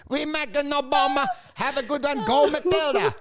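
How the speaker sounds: eager broken English; Ukrainian accent. Retold or imitated. Imitating Zelensky's cheer in a Ukrainian accent. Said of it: "And he goes".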